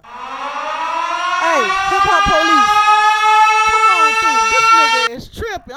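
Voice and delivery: loud mock shout for the hip hop police; mock call for police. Host mockingly yelling for the hip hop police to come. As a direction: bellow out for the cops, hamming it up.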